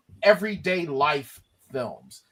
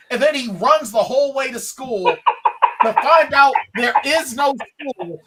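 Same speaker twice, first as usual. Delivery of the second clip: louder and higher, speeding up at the end; building comic exasperation; 7 semitones higher, 7 dB louder than usual. Retold or imitated. Reviewer retells pointless run to school, building comic exasperation. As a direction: build the absurd tale to a loud rush. Recounts absurd film scene, building.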